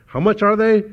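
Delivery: high, loud mock mom; mom's shocked question. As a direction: play the outraged mother asking the price. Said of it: "she was like".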